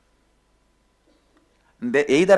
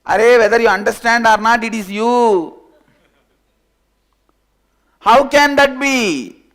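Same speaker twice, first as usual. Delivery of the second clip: loud insisting; 7 semitones higher, 9 dB louder than usual. Acted. Sheep and lion shout back and forth in disbelief.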